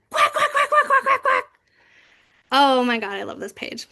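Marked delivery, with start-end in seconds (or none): 0.1-1.4 high, squawking duck quacks; noisy duck. Shown as a demonstration. Reading a noisy duck book to kids, high quacking. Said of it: "Duck is noisy".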